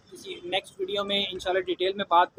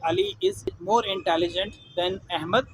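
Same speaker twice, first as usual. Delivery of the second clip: very slow, careful comparison sentence; deliberate teacher dictation; slow example read; much slower than usual. Shown as a demonstration. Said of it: "example sentence read slowly".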